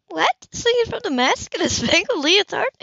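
picture him in a circus leotard, incredulous; amused disbelief. Acted.

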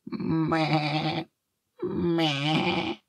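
high bleating meh; sheep bleat. A narrator's reading. (hohes Schafsblöken)

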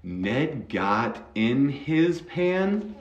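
each word drawn out for a learner; very slow demo. Shown as a demonstration. Teacher to student learning English, very slow. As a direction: spell it out slowly, like a teacher modelling. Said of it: "Listen, listen, listen".

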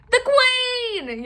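high, loud cry of warning; card gardener yelling the Queen has arrived; yelled alarm. Acted. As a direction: shout it, the Queen is coming. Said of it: "yelled the three of clubs".

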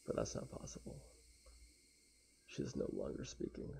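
ordinary talk

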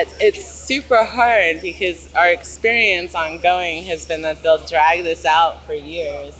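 ordinary delivery

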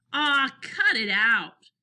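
slow, loud-ish sheepish grumble. A narrator's reading. Henry protests to his teasing friends, sheepish. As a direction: drag it out in embarrassed protest. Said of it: "said Henry sheepishly".

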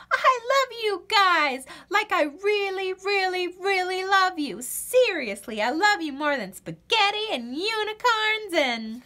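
gushing thanks. Acted. Birdie thanking her animal rescuers, high gushing. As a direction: pour out the love breathlessly.

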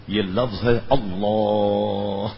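Allah drawn out slowly, the lam held heavy; correct Allah. Shown as a demonstration. Teacher demonstrating the correct Allah to students, slow and drawn out. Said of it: "آخری میں اس کو ساکن بھی کرنا".

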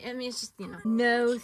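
ordinary talk

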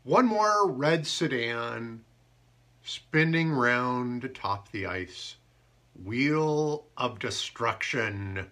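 slow dramatic haiku delivery; haiku reading. Acted. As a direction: read it as a grave poem.

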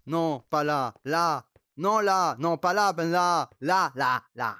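fort, impatient, qui guide; criant des directions. Real, not acted. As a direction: crie où aller, de plus en plus fort. (crier à l'ami où aller)

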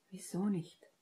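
softly asked, a little hushed; soft, careful question; quiet question. A narrator's reading. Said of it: "fragte Ginny leise".

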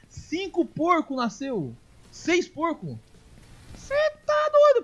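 high, amazed counting then a loud cry; can't believe how many. Real, not acted.

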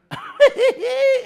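sudden high-pitched laugh; high burst of laughter. Real, not acted. Preacher laughs mid-sentence.

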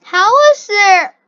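loud, high burst of disbelief at the game; frustrated shout. Real, not acted. Gamer shouting at a confusing map, loud frustrated disbelief. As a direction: yell it at the screen in disbelief.

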